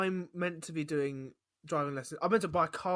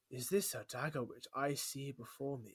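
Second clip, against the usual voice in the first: Shakespeare recital; 3 semitones lower, 6 dB quieter than usual. Retold or imitated. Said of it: "And I was just like".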